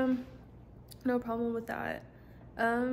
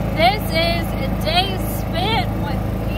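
slow, high, loud intro voice; vlogger intro voice; 5 semitones higher, 7 dB louder, slower than usual. Pretend. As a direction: announce it like a vlog host. Vlog intro, put-on voice.

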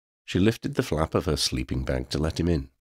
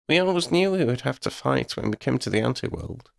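higher-voiced companion; Lydia, matter-of-fact; 8 semitones higher than usual. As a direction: lift the pitch for Lydia's voice.

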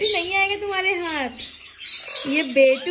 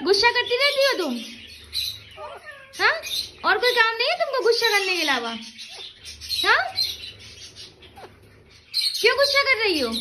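higher-pitched mock scolding of a pet; playful scolding; 6 semitones higher, faster than usual. Real, not acted. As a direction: chide a pet in a high, playful voice. Playfully scolding pet bird for anger.

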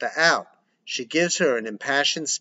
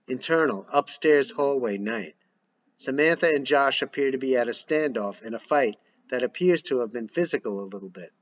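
ordinary delivery

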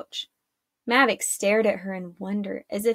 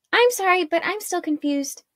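higher-pitched, insistent cutting in; raised, questioning protest; confused interjection; 8 semitones higher than usual. A narrator's reading. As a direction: break in, puzzled and impatient for answers. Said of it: "interjected Arella".

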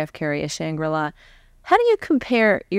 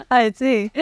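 laughing while speaking; real laugh, high and amused; 8 semitones higher than usual. Real, not acted. Host laughs at Jim's story.

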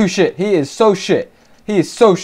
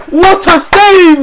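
high, loud, drawn-out cry of delight; elated shout; 8 semitones higher, 5 dB louder, much slower than usual. Real, not acted. Fan praising Sanchez's save, elated shout. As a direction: really belt out the praise, slowly.

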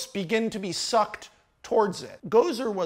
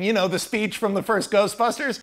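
usual speaking voice

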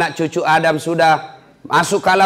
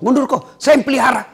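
much higher, fast and urgent; fierce protective prayer; 10 semitones higher, much faster than usual. Acted. Prayer pushes the angel away, high and urgent.

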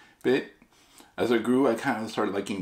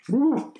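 sour candy yelp; 4 dB louder than usual. Real, not acted.

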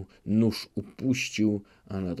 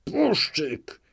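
higher, spat-out insult; furious cry; 7 semitones higher than usual. Acted. Chmielnicki insults the captive officer, furious cry. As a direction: hurl the name in rage. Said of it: "zawołał z wściekłością".

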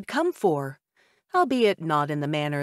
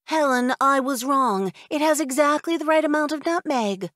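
a higher, put-on woman's voice, conceding; grudging older woman; 8 semitones higher, 4 dB louder than usual. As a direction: admit you were wrong about the nutmeg. Woman in crowd admits defeat.

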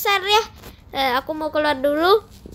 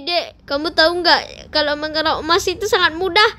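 ordinary talk, nothing marked

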